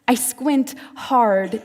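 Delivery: usual speaking voice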